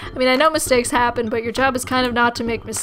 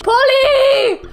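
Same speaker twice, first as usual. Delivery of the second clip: high, loud yelled Polly Parrot calls; Monty Python parrot sketch; 12 semitones higher, 5 dB louder, slower than usual. Retold or imitated. Player recalling the dead parrot sketch, shouting high. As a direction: bellow Polly like the sketch, far higher. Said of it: "the Monty Python sketch".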